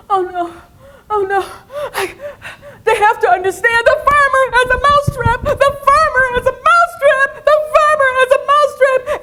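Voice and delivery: high, loud squeaky pleading mouse; desperate mouse. Acted. Mouse desperately pleading that they must understand. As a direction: beg them to understand. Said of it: "the mouse said".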